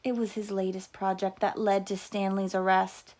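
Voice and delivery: ordinary delivery, nothing marked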